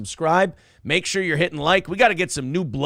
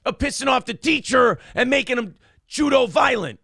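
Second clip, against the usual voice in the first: Scott Steiner impression; 7 semitones higher than usual. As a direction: bellow it like a wrestling promo. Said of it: "That, that was an impression".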